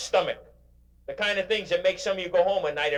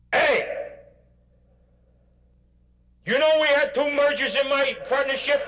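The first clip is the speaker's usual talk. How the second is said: higher, louder, petty griping voice; mock complainer at bedside; 12 semitones higher, 4 dB louder than usual. Speaker acts out a petty complaint to a dying patient, in a higher, louder griping voice. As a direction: whine about trivial troubles to the dying. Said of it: "you have to say".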